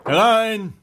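called loud and high toward the door; a cheerful call to come in. Acted. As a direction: shout it through the closed door. Geppetto calls to the door.

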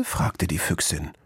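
ordinary delivery

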